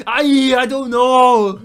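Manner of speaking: slow, high, very loud bellow; bellowing action star. Acted. Other guy answers the confused star, comic bellow. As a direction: holler it as a baffled movie hero.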